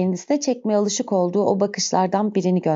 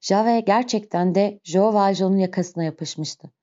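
usual speaking voice

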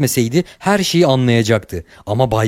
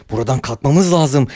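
usual speaking voice

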